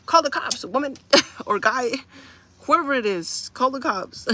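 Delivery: telling viewers, half laughing, to call; loud laughing command. Real, not acted. Urging viewers to call police.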